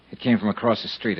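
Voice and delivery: usual speaking voice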